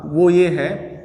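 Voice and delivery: normal talking voice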